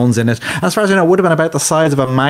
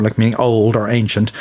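usual speaking voice

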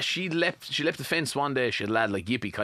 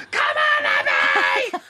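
loud, hammed-up yell of encouragement; big mock shout at a player; sideline mother shouting; 6 semitones lower, 7 dB louder, much slower than usual. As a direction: imitate a fanatical parent bellowing from the sideline. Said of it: "your mother loves to shout".

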